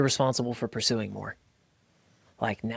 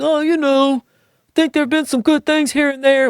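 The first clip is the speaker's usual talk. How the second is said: mimicking a hedging guy in a much higher put-on voice; evasive guy; 13 semitones higher, 6 dB louder than usual. Mocking a man who won't admit what he wants, high evasive voice. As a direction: play the guy dodging the question, pitched way up and louder.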